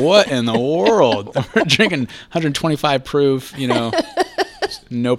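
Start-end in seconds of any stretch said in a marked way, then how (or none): 0.0-1.4 mock bewildered. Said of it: "And it's like".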